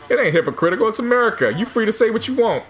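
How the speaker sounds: imitated insistent rebuttal; retold retort. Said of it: "And he goes".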